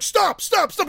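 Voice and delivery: high-pitched imitation of a film cry; mock alarmed cry. Host quotes the woman who comes up, high mock cry. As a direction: rattle off a high mock plea. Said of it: "she's like".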